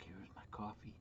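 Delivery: soft unvoiced whisper. Real, not acted. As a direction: breathe it out in a hushed whisper. Back with fresh coffee.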